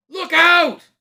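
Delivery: very high, loud cry; warning shout. Acted. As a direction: yell a sudden warning. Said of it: "called several voices".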